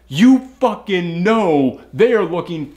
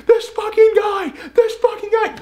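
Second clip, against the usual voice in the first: mock screaming. Retelling the patient's outburst, high mock screaming. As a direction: shriek it in mock panic. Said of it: "started screaming".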